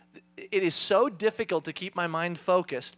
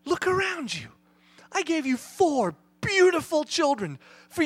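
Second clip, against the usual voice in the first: raised-pitch, emphatic fatherly rebuke; exasperated scolding; 6 semitones higher, 5 dB louder than usual. Retold or imitated. God reproving a dad who complains in the shower, scolding. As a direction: scold him, punching the key words.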